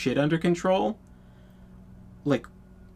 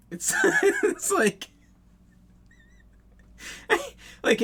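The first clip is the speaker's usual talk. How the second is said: laughing. Real, not acted. Laughing at his own punchline.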